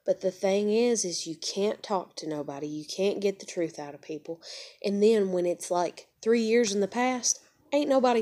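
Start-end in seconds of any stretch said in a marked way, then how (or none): none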